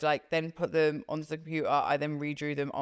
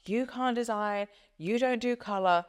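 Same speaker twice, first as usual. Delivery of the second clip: higher, dismissive mock rant ending in la la la; mocking boss rant; 5 semitones higher than usual. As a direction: mimic a boss tearing into you, trailing off into la-la. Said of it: "she's like, ripping into me".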